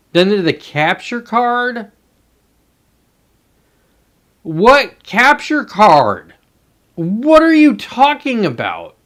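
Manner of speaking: high, drawn-out exasperated cry; exasperated disbelief. Real, not acted. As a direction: stretch it out in utter disbelief. Exasperated at nonsense instructions.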